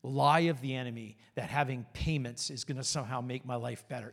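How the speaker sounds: low, hushed private rebuke; quiet prayer under the voice; inward prayer. Shown as a demonstration. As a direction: say it softly, as if only in your heart. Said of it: "in your heart to say".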